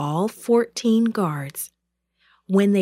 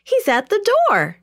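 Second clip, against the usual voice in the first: very high, loud, delighted announcement; joyful shout; 13 semitones higher, 5 dB louder than usual. A narrator's reading. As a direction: shout the happy news to everyone. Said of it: "she shouted joyfully".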